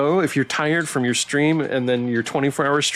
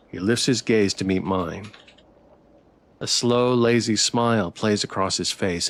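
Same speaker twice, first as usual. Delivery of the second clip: story narration; 4 semitones lower than usual. Acted. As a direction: read the story evenly to chat. Reading visual novel narration aloud.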